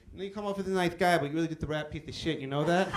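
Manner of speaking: quick, lisping boxer impression; mock soft-voiced lisping menace; Mike Tyson. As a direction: lisp the threat out fast. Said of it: "he goes".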